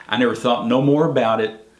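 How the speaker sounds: usual speaking voice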